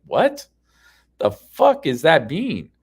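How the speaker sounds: exasperated demand for sense; incredulous retort. Retold or imitated. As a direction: drag out the disbelief, loudly. Retelling his baffled argument reply.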